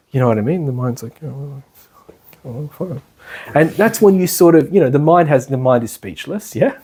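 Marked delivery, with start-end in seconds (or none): 1.2-3.0 much softer, deflated; sulky giving in. Pretend. The defeated mind conceding to the meditator, much softer and sulky. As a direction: let the mind concede grudgingly.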